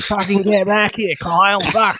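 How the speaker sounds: high, drawn-out mock yell; hammed-up shout across a crowded terminal; imitated shouting. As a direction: holler like an Aussie parent after a kid. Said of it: "shouting at their".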